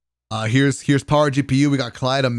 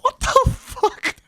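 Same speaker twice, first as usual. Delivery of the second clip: whining disbelief; much slower than usual. Retold or imitated. Retold reaction to warm towel.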